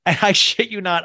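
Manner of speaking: normal talking voice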